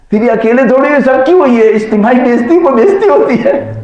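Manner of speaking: very high-pitched comic protest; mock exclaiming. Colleague telling the depressed manager everyone was insulted, mock exclaiming. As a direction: pitch it way up like an indignant punchline. Punchline repeated as the consoling colleague.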